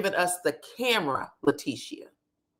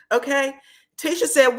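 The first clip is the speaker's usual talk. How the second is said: ordinary delivery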